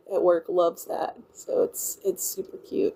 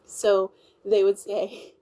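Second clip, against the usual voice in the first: ordinary delivery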